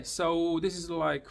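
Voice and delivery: ordinary delivery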